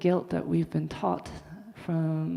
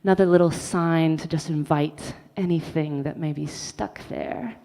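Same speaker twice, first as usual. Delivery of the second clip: ordinary talk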